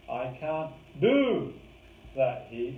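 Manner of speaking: slow, drawn-out do; stress on do. Shown as a demonstration. Coach showing capability emphasis, stress on do. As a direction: dwell on the word do. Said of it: "Emphasizes capability".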